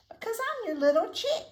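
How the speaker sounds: very high, loud chick squeak; little chick voice. A narrator's reading. Chick tells mommy why he loves her, high loud squeak. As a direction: pipe up gleefully as the chick.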